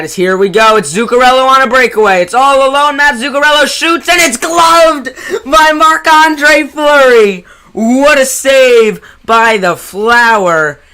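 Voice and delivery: excited shout. Acted. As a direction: scream the big save call. Breakaway save, shouting call.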